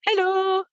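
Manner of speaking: quick cheery Dutch hallo; Dutch hello. Shown as a demonstration. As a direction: say hello the Dutch way, lilting high. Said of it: "the only bit of Dutch I know is".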